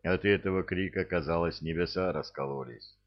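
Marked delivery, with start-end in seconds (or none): none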